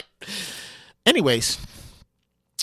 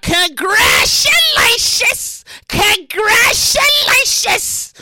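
high, very slow stretched-out cheer; drawn-out announcer shout; 11 semitones higher, much slower than usual. Acted. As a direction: stretch every syllable like a hype announcer. Celebratory announcement.

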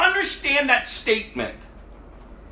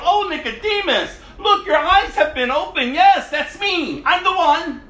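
mock cheerful Jesus; 6 semitones higher than usual. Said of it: "instead of applauding him and going".

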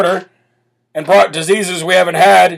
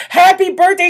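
mock party cheer; 11 semitones higher than usual. Mimicking party guests.

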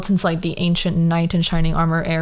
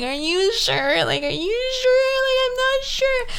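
high, teary pleading; crying voice; slower than usual. Retold or imitated. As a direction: squeak it out through tears. Crying to him on FaceTime.

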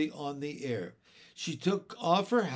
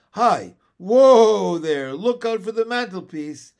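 put-on jolly, giggly old man, louder; jolly old uncle; 6 semitones higher, 14 dB louder than usual. Uncle greeting children, lightly voiced jolly old man. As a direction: chortle it out like a tipsy uncle. Mr Wig laughing to Jane.

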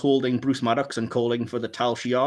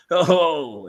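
mock hammy, drawn-out "oh"; over-the-top acting; 3 semitones higher, 3 dB louder than usual. Fan mocks the captain's silly disguise act, over the top. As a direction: ham up a long theatrical exclamation. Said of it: "gonna go really over the top".

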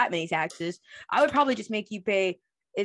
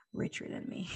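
whispered; 12 dB quieter than usual. Real, not acted. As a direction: whisper the punchline. Whispered punchline aside.

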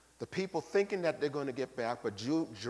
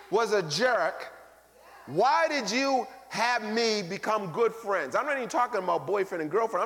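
ordinary talk, nothing marked

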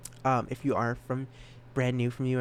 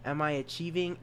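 ordinary talk

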